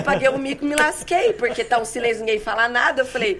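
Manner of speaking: loud, high laughing retelling; giggling through the embarrassing memory. Real, not acted. Laughing while quoting embarrassment. (rindo enquanto conta o mico)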